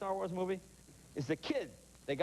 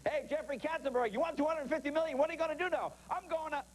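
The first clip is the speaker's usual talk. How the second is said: higher-pitched hype-man questioning; mock announcer call-out; 10 semitones higher than usual. Host mock-interviewing a lawsuit winner, high and upbeat. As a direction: put it like an excited ad interviewer, pitched up.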